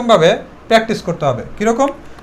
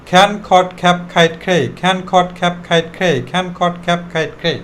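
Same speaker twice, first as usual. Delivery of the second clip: run-together drill. Shown as a demonstration. Teacher shows practice for fluency, run-together drill. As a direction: rattle the list round without a break. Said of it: "এভাবে যদি বলো".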